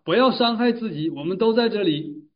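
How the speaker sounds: acted urgent shout. Paul calls to the jailer to stop him, urgent acted shout. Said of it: "他忙竭尽全力地喊道".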